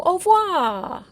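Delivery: slow, high, loud French "Au revoir"; French farewell. Pretend. Vlogger signing off to viewers, slow high mock-French "Au revoir". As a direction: draw out "Au revoir" like a grand French goodbye.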